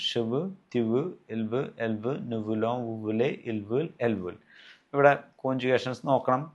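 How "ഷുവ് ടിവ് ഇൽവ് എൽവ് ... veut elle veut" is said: slower, careful second run of il veut, elle veut; teacher repeating the conjugation more slowly; slow repeat drill. Shown as a demonstration. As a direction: say each form slowly for learners to copy. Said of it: "ഒന്നുകൂടി പറയാം, വീണ്ടും ശ്രദ്ധിക്കുക".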